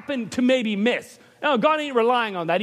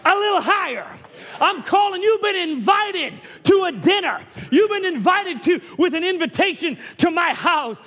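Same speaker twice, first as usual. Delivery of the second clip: the eagle calling the seed home; eagle's call; 6 semitones higher than usual. Pretend. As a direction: call out like a screeching eagle from on high. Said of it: "It's saying".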